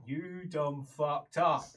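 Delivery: drawn-out, slow reproach; slow scolding. Acted. Man cutting off her excuses, slow scolding. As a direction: stretch out every word of the verdict. Man repeats his verdict.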